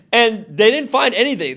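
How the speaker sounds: laughing as he tells it; amused. Real, not acted. Man telling host about the fruitless raid, laughing. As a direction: let a laugh into the line, pitch lifted.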